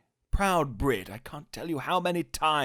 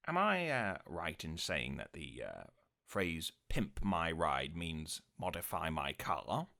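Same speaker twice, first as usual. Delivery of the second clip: David Mitchell impression; 7 semitones lower, 6 dB quieter than usual. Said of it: "David Mitchell".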